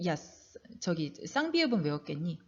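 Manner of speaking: usual speaking voice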